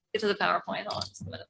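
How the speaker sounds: normal talking voice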